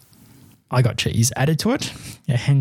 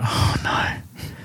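muttered dread; 4 dB quieter than usual. Retold or imitated. Dismayed reaction seeing mayo.

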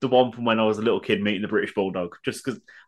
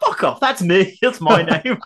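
louder, higher protest breaking into laughter; mock indignation; 8 semitones higher, 6 dB louder, slower than usual. Retelling [name] refusing to sign, indignant. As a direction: insist loudly, half laughing. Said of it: "I was like".

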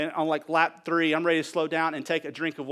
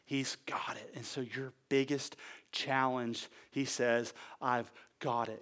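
soft drop after the climax; hushed reassurance; 4 semitones lower, 9 dB quieter, slower than usual. Real, not acted. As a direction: let it settle quietly over them. Sudden hush after preaching peak.

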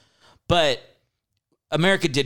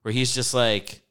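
ordinary talk

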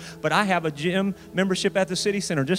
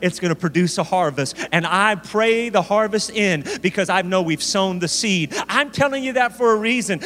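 raised, insistent preacher voice; impassioned preaching; 5 semitones higher than usual. Real, not acted. Preacher challenging listeners on their harvest, fervent preaching. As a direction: drive each question at the congregation.

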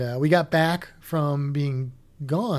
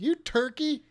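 mock scolding mom; 9 semitones higher, slower than usual. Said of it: "She was like".

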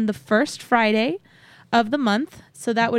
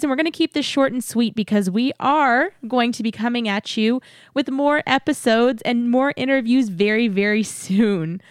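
ordinary talk, nothing marked

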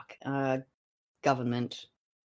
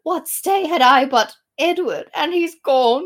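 loud, high theatrical lament; Queen Elizabeth; 7 semitones higher, 7 dB louder than usual. Acted. Queen mourning Edward, mock theatrical lament. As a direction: declaim the grief loudly, hammed up. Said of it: "Queen Elizabeth".

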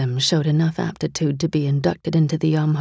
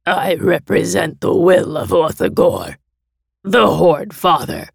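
louder, diseased, rasping monster envoy; diseased delegate; 3 semitones higher, 5 dB louder than usual. A narrator's reading. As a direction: speak like a throat eaten by disease. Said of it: "his voice sounding as though his throat had been racked by the same disease".

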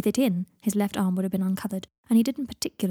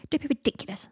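snapped; 6 semitones higher than usual. Acted. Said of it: "snapped Pansy".

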